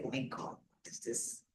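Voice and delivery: hushed, breathless whisper of shame; ashamed whisper. Retold or imitated. Coach recalling her shame, whispering. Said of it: "So I felt very shame, like".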